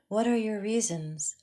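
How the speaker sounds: ordinary delivery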